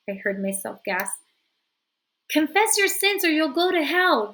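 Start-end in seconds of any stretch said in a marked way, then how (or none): 2.3-4.3 loud, high frantic cry. A narrator's reading. Rita crying out at Florence, loud and high. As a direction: scream the threat of hell. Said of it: "Rita cried out".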